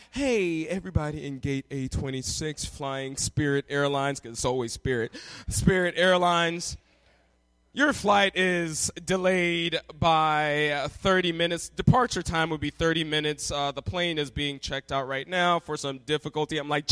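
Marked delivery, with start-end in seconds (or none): 0.1-4.2 low, level gate announcement; airline gate announcer. Retold or imitated. Airline staff to waiting passengers at the gate, announcing. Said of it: "and then they're like". 5.5-16.6 low, drawn-out announcement voice; airline gate announcer. Retold or imitated. Gate agent tells passengers of a delay, announcing. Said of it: "and then they're like".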